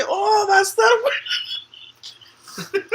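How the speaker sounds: high, slow, laughing mock quote; grandma's line imitated through laughter; imitating nana through laughter. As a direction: squeal it out while cracking up. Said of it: "She would say".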